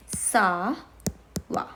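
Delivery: "ça va" with a long "ça", pause, then "va"; teacher's slowed-down "ça va"; word by word. Shown as a demonstration. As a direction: draw out and separate "ça va". Teacher separates the two words.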